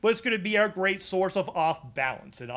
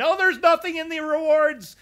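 high, louder mock whine of complaining players; whiny complaint; 11 semitones higher, 6 dB louder than usual. Streamer mocking complaining players, high whiny voice. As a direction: mimic the griping players, pitched up and loud. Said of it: "And people complain".

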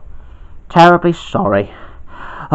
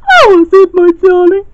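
coy and sweet, much higher; high girl voice; 12 semitones higher than usual.

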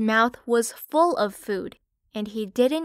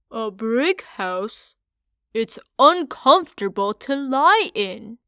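scornful little pig, louder on the second line; mocking piglet; 7 dB louder than usual. Parley laughing at Park's brick house, scornful. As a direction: make fun of your brother's idea. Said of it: "said Parley".

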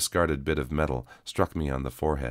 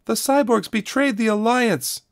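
much higher, louder screamed accusation; 7 dB louder than usual. A narrator's reading. A woman in the crowd screams her accusation. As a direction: shriek it at the truth-teller. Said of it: "screamed a woman".

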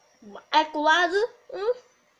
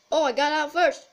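ordinary delivery

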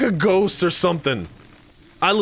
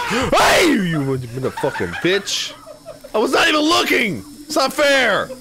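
raging gamer; 6 semitones higher than usual. Real, not acted.

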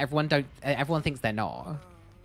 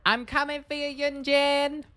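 high, drawn-out villain taunt; high singsong menace toward the fleeing survivor; creepy taunt; 12 semitones higher than usual. Acted. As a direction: call out sweetly like a stalking killer. Taunting the survivor he's chasing.

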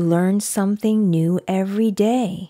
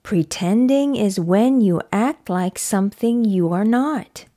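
ordinary delivery

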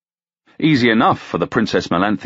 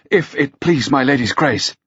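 quick and hoarse; 6 semitones higher than usual. Acted. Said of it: "quick and hoarse".

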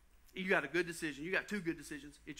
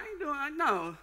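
higher, alarmed protest; startled no, pitched up; panicked refusal; 8 semitones higher, 4 dB louder than usual. Retold or imitated. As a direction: balk in a higher voice. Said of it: "And I'm like".

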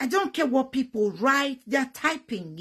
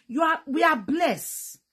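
ordinary talk

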